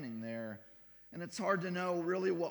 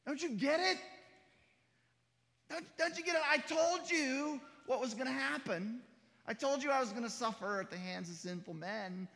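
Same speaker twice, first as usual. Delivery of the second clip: high, loud mock rebuke; incredulous reproach, starting high and easing down; exasperated scolding; 8 semitones higher, 3 dB louder than usual. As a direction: act out an impatient telling-off, pitched way up. Imagined exasperated scolding of Mary.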